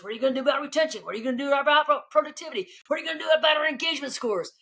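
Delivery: high-pitched, rapid-fire grilling; imitated attacking questions. Team member grilling the CEO at breakfast, imitated attack. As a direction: fire off each question fast, voiced well up high. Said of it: "felt like she was attacking me".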